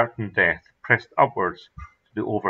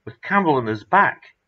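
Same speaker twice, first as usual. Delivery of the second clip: louder, excited confirmation; emphatic reply; 6 semitones higher, 4 dB louder than usual. Acted. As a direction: insist it really happened. Confirming the impossible rescue.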